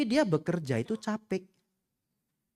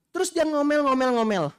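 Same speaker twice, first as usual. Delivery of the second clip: high, loud mock nagging; 12 semitones higher, 5 dB louder than usual. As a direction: play the nagging in-law. Describing nagging in-law. (omelan tinggi dan keras, pura-pura)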